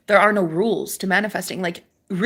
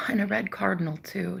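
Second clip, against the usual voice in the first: normal talking voice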